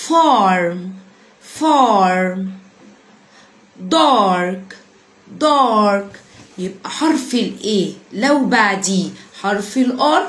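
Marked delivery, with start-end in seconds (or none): 0.1-2.4 "Farm" with a long, heavy back 'ah', lower; emphatic A before R. Shown as a demonstration. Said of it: "انطقه مفخم". 3.9-6.1 "Dark" with a long, heavy back 'ah', lower; emphatic A before R. Shown as a demonstration. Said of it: "انطقه مفخم".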